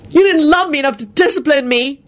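high-pitched heated retort; indignant, high young voice. Acted. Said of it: "Jamie replied heatedly".

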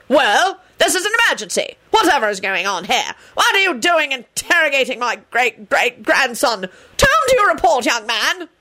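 high, imperious great-grandfather; indignant, lofty old relative; haughty elder. A narrator's reading. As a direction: demand answers from a junior, pitch swooping. Haughty intruder demands a report.

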